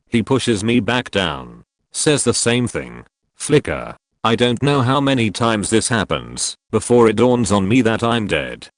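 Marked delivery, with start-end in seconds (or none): 3.3-4.0 low flicker. Acted. Narrating the trip loop, a low dropped flicker. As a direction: say it low like a blink of lost time. Repeated refrain.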